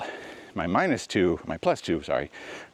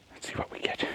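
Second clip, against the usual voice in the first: whispering; 8 dB quieter than usual. Real, not acted. Filming quietly on the trail.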